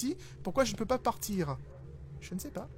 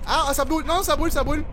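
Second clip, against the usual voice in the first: loud, higher cries of pain; pained yelling; 6 semitones higher, 6 dB louder, faster than usual. Real, not acted. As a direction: yell like it burns. Burned in game.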